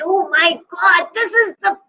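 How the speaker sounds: high-pitched overjoyed polar bear; delighted storybook exclamation; so happy. A narrator's reading. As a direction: burst with joy at the new home. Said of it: "he was so happy and said".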